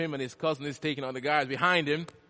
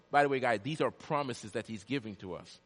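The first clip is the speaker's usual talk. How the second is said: ordinary talk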